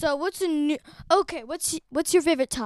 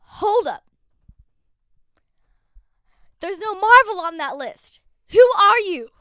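loud, raised, incredulous outburst; mock shock; 3 semitones higher, 9 dB louder than usual. Interviewer confronting host over his list, mock-shocked. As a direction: build to an outraged challenge. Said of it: "constantly be shocked at me".

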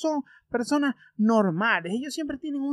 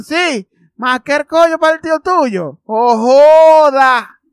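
mocking crowd; 5 semitones higher, 14 dB louder, slower than usual. Everyone in shop mocking him.

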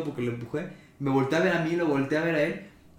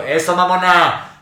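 exclamación lenta; 2 semitones higher, 11 dB louder, much slower than usual. Retold or imitated. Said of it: "y yo".

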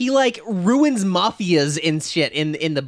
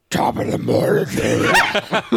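slow, weird, inhuman croak; Judi Dench; slower than usual. Retold or imitated. Exaggerating her entrance for laughs, weird Batman-like voice. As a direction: drag it out like a smoker doing Batman. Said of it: "like, a weird".

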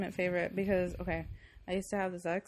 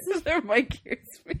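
laughing imitation; 6 semitones higher, 4 dB louder than usual. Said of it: "We gotta get better mics".

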